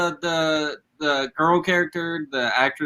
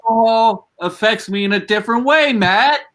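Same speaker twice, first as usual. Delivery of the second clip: mock indignant; 7 semitones higher, 3 dB louder than usual. Mock defensive boast.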